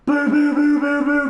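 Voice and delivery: rapid, loud, high "boo" gunfire noises; mouth-made gunshot imitation; imitated pistol shots. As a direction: mimic a pistol firing over and over. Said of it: "he's using the pistol and just going".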